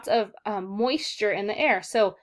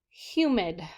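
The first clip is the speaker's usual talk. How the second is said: ordinary talk, nothing marked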